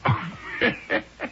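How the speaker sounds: long, high wheezing laugh; amused cackle. Acted. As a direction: burst out laughing at the name. Amused at the name Cuthbert.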